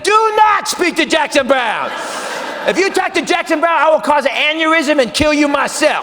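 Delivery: high, loud frantic warning; yelling inner voice. Retold or imitated. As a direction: scream a warning at yourself.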